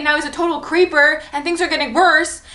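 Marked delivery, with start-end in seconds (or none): none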